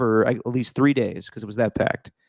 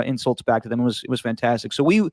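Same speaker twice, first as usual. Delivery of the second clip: ordinary talk, nothing marked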